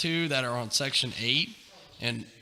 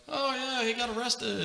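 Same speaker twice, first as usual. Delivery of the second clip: higher mock girlfriend voice; girlfriend's voice; 10 semitones higher, 4 dB quieter than usual. Retelling the girlfriend answering the door, in a higher mock voice. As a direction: pop it up high like the tenant's girlfriend.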